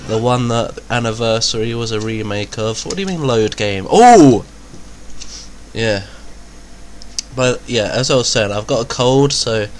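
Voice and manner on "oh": loud high startled oh; jolted by something on screen; startled yelp. Real, not acted. Startled while playing.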